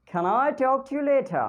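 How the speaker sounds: British English accent. Shown as a demonstration. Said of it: "I'm going to speak in England accent".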